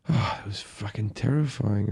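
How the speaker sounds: low, hushed shaken relief. Retold or imitated. Driver alone in McDonald's, quietly shaken up. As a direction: say it softly to yourself, shaken. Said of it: "I'm all shaken up".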